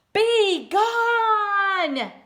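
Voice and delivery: loud high bellow; loud high bear roar. Acted. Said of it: "roared Bear".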